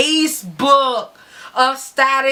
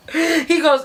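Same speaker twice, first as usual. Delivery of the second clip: normal talking voice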